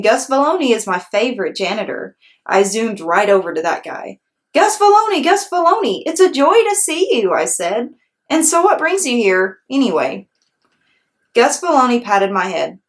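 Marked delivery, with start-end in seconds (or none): 4.5-10.3 put-on excited little girl. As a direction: greet your favorite janitor with joy. Junie B greets janitor.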